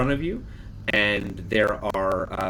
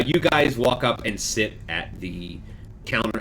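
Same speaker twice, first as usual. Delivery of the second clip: ordinary delivery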